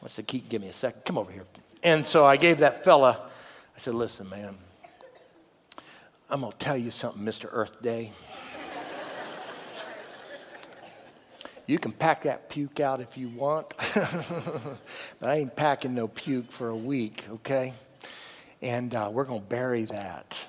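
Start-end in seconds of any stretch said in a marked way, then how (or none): 0.3-1.4 low, soft, restrained word to a son; retold hushed fatherly instruction; quiet aside. Dad setting up to confront the guide, quiet aside to his son. 3.9-20.4 low, soft, measured warning; mock-stern talking-to, held down low; quiet confrontation. Dad setting the young guide straight about packing vomit, quiet confrontation.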